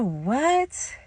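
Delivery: soft, stunned question with rising end; quietly taken aback; retold shock. Teller to friend demanding she get pregnant, stunned disbelief.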